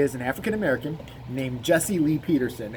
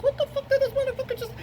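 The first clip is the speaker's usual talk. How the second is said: baffled; faster than usual. Retold or imitated. Young people's baffled reaction.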